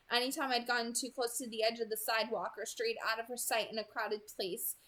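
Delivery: ordinary delivery, nothing marked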